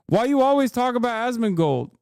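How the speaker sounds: high, loud whiny mock of a commenter; mocking chatter. Streamer imitating a troll commenter, loud whiny mock. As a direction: parrot the troll's whine. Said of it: "Did you see the Asmongold response".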